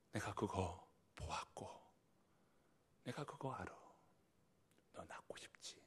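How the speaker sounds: hushed, intimate comfort; whispered reassurance. Acted. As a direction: whisper it close to the hurting one. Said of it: "주님이 아시고 말씀하시는 거예요". (주님이 다정하게 속삭이듯)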